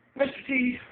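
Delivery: high, loud summons; calling out. Acted. Comic calling his imagined phone assistant, calling out. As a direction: call the name out loudly.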